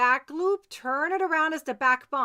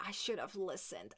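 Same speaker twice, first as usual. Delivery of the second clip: retold regret; 6 semitones lower, 14 dB quieter than usual. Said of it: "you will be frustrated later and say".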